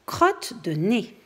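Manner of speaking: drawn-out, slow title call; slow title read. Acted. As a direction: announce the title slowly, savouring it. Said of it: "title said again, slowly".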